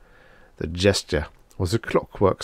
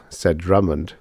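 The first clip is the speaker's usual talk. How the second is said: ordinary delivery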